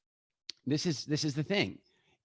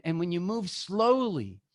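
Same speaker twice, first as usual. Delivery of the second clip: ordinary talk, nothing marked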